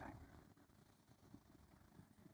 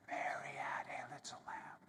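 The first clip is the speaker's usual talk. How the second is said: soft unvoiced whisper of the rhyme; 9 dB quieter than usual. Shown as a demonstration. Yoga teacher demonstrating a whisper to the class. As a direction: breathe the words out with no voice.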